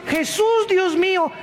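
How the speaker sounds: high, mock frightened cry to God; mock panic. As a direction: cry out like a scared man in a quake. Mocking panicked people crying out. (grito burlón de miedo)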